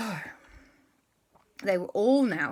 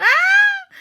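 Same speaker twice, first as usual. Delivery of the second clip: shrill frightened shriek; high scream; 17 dB louder than usual. Acted. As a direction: scream in terror. Aunt screams.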